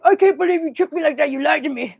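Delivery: husband griping that his wife fooled him, mock angry; mock outrage. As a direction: play the betrayed husband, hammed-up. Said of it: "He was so mad".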